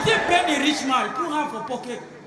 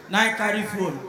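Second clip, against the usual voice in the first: ordinary talk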